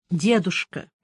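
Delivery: ordinary delivery, nothing marked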